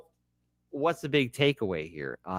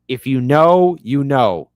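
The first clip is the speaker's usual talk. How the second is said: louder punchy take of If you know, you know; emphatic repeat; 9 dB louder, slower than usual. Shown as a demonstration. Shouting out The Wire fans, louder and emphatic. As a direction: lean in hard on know.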